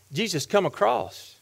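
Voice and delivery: ordinary talk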